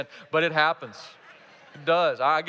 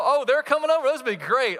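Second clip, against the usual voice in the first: high, loud, delighted anticipation; acted thrill, pitch leaping up; excited; 7 semitones higher, 6 dB louder than usual. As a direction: gush at news of welcome visitors. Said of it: "are they excited?".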